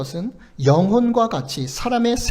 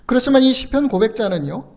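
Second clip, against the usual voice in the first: ordinary talk, nothing marked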